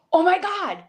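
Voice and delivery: high, imitated gush of amazement; amazed gasp. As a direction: play a friend stunned by the boots. Imitating Sonny's amazed reaction.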